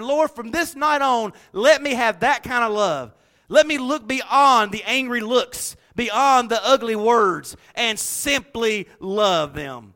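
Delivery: slow, loud, pleading prayer voice; earnest prayer. Acted. Preacher leading the congregation in prayer, slow fervent prayer. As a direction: pray it slowly from the heart.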